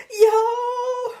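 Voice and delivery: very high, loud yell; scream. A narrator's reading. Leslie suddenly screaming to get Paul caught, loud high yell. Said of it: "Leslie screamed".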